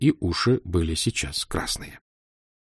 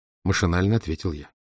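ordinary delivery

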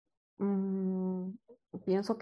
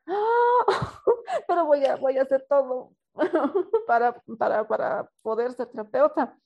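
higher and louder, laughing through a desperate promise; laughing plea; 6 semitones higher, 5 dB louder than usual. Retold or imitated. Ingrid promising the interviewer anything, laugh-crying. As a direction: beg while half laughing, half crying.

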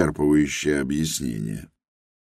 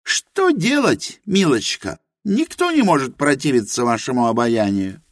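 Helena's voice; 7 semitones higher than usual. A narrator's reading. Helena admits her ignorance to Rosa, high and earnest. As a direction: confess humbly in Helena's high voice.

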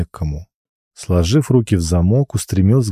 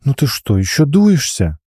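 sulky little princess whine; bossy little princess; 6 semitones higher than usual. Acted. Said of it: "Она капризно надула губки".

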